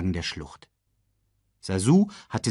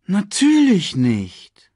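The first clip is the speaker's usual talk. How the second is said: slow, louder mock comfort; false sympathy; 9 semitones higher, 7 dB louder, much slower than usual. A narrator's reading. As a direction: drag it out, feigning sympathy. Scar feigns sympathy slowly. (Scar, langsam und falsch mitfühlend)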